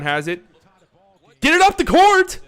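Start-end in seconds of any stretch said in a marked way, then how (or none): 1.4-2.4 much higher, loud shout at the team; urgent yell. Real, not acted. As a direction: hurry them up the court. Yelling at the team to hurry.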